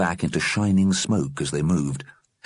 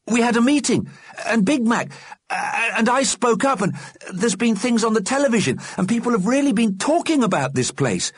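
put-on pleading boy's voice; 3 dB louder than usual. Johnny pleads with the dead.

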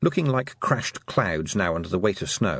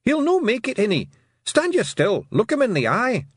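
high, urgent Scots shout; pitched way up, broad Scots; tiny blue man; 13 semitones higher, 4 dB louder than usual. A narrator's reading. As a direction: yell orders like a feisty little Scotsman. Blue man urges her on.

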